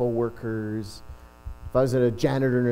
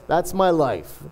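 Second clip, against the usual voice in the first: ordinary talk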